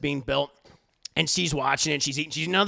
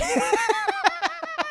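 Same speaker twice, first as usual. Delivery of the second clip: squealing laugh, much higher; high burst of laughter; 3 dB louder than usual. Real, not acted. Cracking up at his own outburst: squealing laugh. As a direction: break into a high-pitched laugh.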